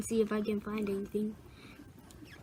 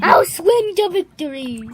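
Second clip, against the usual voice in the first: high, loud playful toy voice; 6 semitones higher, 11 dB louder than usual. Pretend. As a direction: cheer it like a toy hero. Voicing the toy figure.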